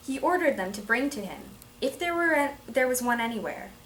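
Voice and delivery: animated story reading for the class; expressive storytelling. Shown as a demonstration. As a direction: tell it like an engaging fable. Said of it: "trying to put expression into it".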